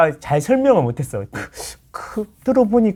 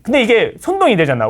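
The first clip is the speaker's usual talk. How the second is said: ordinary talk, nothing marked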